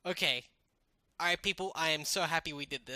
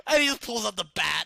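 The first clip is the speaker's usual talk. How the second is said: loud laughing burst; laughing shout; 2 semitones higher, 9 dB louder than usual. Real, not acted. Laughing at imagined Casey scene.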